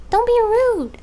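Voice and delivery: high, loud mock scold. Telling off the drawing program, high and loud. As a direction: scold it like a rude child.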